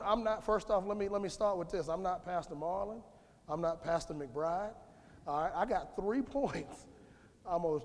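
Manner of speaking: usual speaking voice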